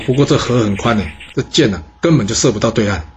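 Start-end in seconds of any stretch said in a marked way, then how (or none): none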